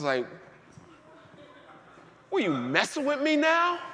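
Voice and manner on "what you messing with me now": much higher, louder disbelief; incredulous retort. Retold or imitated. Preacher retorting to God after the tears, incredulous. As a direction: throw it back at God in disbelief.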